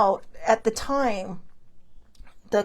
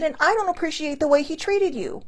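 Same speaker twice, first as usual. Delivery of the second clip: higher, indignant, stress on don't; retold indignation; 6 semitones higher than usual. Defending sibling against the doctor, pitched-up indignation. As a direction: object firmly, pitched up.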